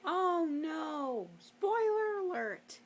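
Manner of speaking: high, slow, hammy warning; mock alarm. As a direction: call out the spoiler alert.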